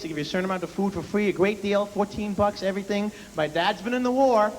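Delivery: ordinary talk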